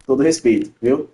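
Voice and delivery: normal talking voice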